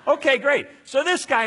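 loud, high laughing burst. Real, not acted. Preacher laughing with the crowd over the happy ending. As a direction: laugh out the joy of it. Laughing at the joyful story moment.

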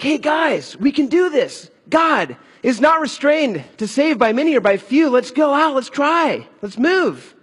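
rallying the troops. Acted. Jonathan rallying his men, acted.

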